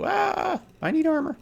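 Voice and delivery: high, loud panicked squeal; hammed-up shriek of a pilot being primaried; mock panic. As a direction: squeal it out like a pilot under fire. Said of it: "and you go".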